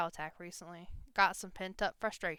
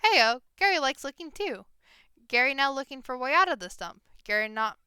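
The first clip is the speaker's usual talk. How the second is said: loud, high goblin chatter; 4 semitones higher, 8 dB louder than usual. Acted. Goblin Gary greets Kitty, loud and high. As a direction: greet them like an eager little goblin. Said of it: "the goblin shouts".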